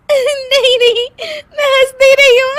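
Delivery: loud, very high denial through laughter; laughing denial. Acted. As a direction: insist otherwise while bursting with giggles. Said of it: "हंस क्यों रही हो". (खिलखिलाते हुए इनकार)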